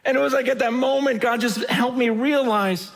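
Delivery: normal talking voice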